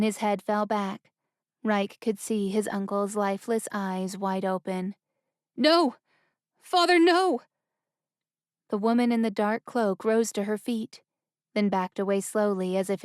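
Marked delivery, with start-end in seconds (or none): 5.6-7.4 higher, louder grief-stricken shout; anguished cry. Acted. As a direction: cry out in despair. Prince sees father dead.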